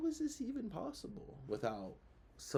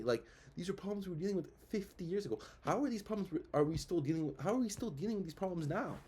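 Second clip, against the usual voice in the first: ordinary talk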